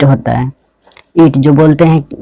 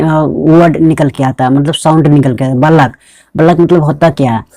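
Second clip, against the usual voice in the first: normal talking voice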